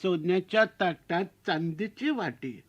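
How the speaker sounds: put-on slow, soft, old-fashioned recital; mother-in-law's couplet. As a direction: recite it gently like an elder. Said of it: "सासूबाईंनी उखाणा घेतला होता".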